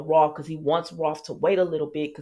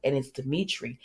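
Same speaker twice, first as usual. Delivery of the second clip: ordinary talk, nothing marked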